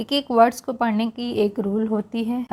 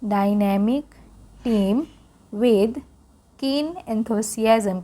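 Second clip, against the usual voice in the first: slow repeat reading; much slower than usual. Shown as a demonstration. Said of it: "फिर से पढ़ेंगे".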